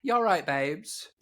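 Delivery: cheeky put-on London accent; bad British accent. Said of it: "bad accents".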